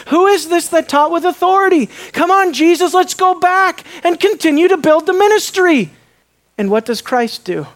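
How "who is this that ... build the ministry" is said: eager urging. Acted. Disciples urging Jesus back.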